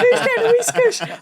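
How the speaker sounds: put-on very high, squeaky little-kid voice; high playful child voice; imitating a toddler. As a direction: chirp it like an excited toddler.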